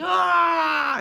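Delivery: sudden high, loud hammed-up wail; anguished mock wail. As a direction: let out a cartoonish cry of grief. Mock weeping superhero cries out.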